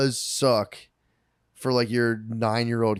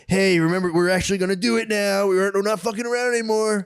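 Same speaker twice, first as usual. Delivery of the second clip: mock announcement; 8 semitones higher than usual. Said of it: "then they're like".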